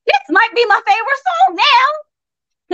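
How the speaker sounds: high, drawn-out delighted realization; pleasantly won over. Retold or imitated. Listener hearing a song several times, suddenly won over. Acted change of mind about song.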